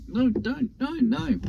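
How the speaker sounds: high, anguished cries of no; pained protest. Retold or imitated. Recalling painful defeat moment.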